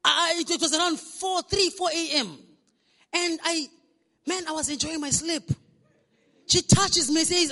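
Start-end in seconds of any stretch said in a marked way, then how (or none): none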